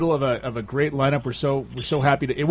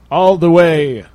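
loud, drawn-out, higher announcer voice; grand announcer intro; 5 semitones higher, 6 dB louder, much slower than usual. Pretend. Host introduces a caller from the Mitten State, grand announcer voice. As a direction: stretch it out like a showman's introduction.

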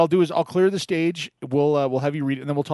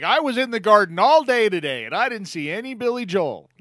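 mock JT quote; 8 semitones higher, 4 dB louder than usual. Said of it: "J T's like".

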